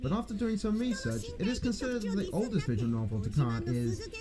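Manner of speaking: ordinary talk, nothing marked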